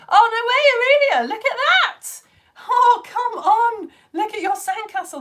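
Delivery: high, loud, drawn-out amazement; big excited squeal of a reaction; thrilled disbelief. Real, not acted. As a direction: exclaim in delighted disbelief, stretching the words. Gushing over viewers' pictures.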